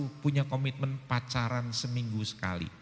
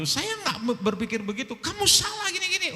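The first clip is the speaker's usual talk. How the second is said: raised voice in argument. Retold or imitated. Spouse arguing during a marriage conflict, raised voice. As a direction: argue your side hard, mock heated. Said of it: "Anda bersuara begitu".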